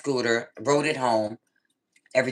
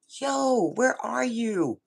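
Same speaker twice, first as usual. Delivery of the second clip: high-pitched retold demand, drawn out; exasperated demand; 7 semitones higher, much slower than usual. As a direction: demand an answer down the phone, high. Retold irate phone call.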